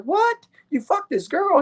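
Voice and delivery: sharp high disbelieving what; David shocked at the confession; surprised. Retold or imitated. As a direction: jump up in surprise.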